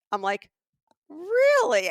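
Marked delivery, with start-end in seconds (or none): 1.1-1.9 loud, high-pitched disbelief; caught off guard. Retold or imitated. Shopper hearing he is from Italy, loud shocked disbelief. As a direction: blurt it out, utterly thrown. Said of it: "I was so caught off guard".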